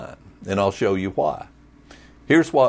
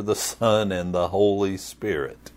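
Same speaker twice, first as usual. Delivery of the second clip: usual speaking voice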